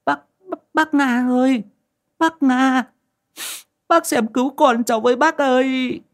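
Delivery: high-pitched, sobbing plea for help; panicked, crying cry for help; tearful wailing. A narrator's reading. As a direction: wail like a mother begging for her baby's life. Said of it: "bế đứa bé vài tháng tuổi sang mà mếu máo".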